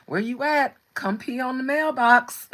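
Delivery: high, loud teasing call to a husband to come claim his spot; hammed-up mock summons to a husband. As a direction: summon him like a dog to mark territory. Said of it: "I'm like".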